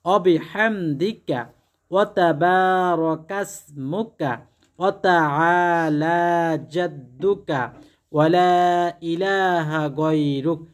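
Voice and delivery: each Arabic syllable drawn out very slowly, a measured chant; slow careful recitation. Shown as a demonstration. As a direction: recite the prayer slowly, syllable by syllable, as a model to copy. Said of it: "এভাবে".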